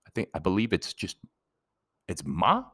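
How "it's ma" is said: "ma" sliding sharply upward like a question; second tone. Shown as a demonstration. Explaining the Mandarin question word, second tone. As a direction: say "ma" with a rising pitch. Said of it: "which is the second tone".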